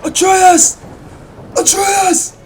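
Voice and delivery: very high, very loud scream of a name; pained yell. Acted. Kratos clutching his head, falling, screams in pain. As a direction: scream out for him in agony. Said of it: "yelling and falling to the ground".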